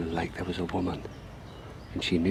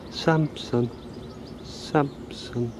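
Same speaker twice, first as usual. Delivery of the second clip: mock call of a lady calling her swan, higher; 5 semitones higher, much slower than usual. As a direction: tease her by calling the swan name in a sing-song. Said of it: "taking the piss out of that lady going".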